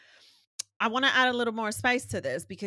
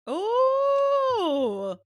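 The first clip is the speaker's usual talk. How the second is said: long, high, loud hoot of reaction; crowd-style high holler; loud impressed "ooh"; 10 dB louder than usual. Real, not acted. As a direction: whoop a drawn-out ooh at a zinger. Listener reacts to the punchline.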